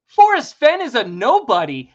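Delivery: loud, forceful declaration; emphatic repeat. Real, not acted. Repeats his claim for emphasis.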